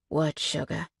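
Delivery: soft, low coaxing; encouraging. A narrator's reading. Cade coaxing the heroine to go on, softly. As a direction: gently coax her on. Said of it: "Cade encourages".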